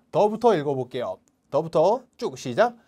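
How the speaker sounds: ordinary delivery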